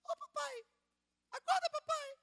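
put-on very high child's voice calling daddy; squeaky little daughter waking dad up; little girl's voice. Preacher imitating his little daughter waking him, high child voice. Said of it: "escuto uma vozinha falando assim".

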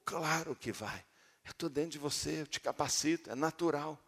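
soft, quiet comforting voice; gentle reassurance. Acted. Preacher voices God promising to enable the believer, soft and reassuring. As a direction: reassure them tenderly that it comes naturally.

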